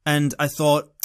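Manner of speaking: ordinary delivery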